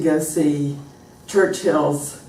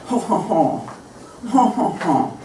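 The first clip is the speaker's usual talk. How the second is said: French-style "ho, ho, ho" warm-up; French accent; 4 semitones higher, slower than usual. Shown as a demonstration. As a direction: find the French melody in a mock chuckle. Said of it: "of the French accent".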